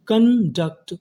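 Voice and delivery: Conduct split as कन, duct, stretched; syllable-by-syllable. Shown as a demonstration. Teacher building conduct for students, drawn out and split. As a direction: pause between the halves of conduct. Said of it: "तो meaning क्या बनी".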